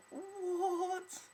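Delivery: very high, loud, drawn-out "what?"; mock-shocked squeal; incredulous reaction. Reacting to a stranger's bad line, high incredulous cry. First reaction to a retold chat-up.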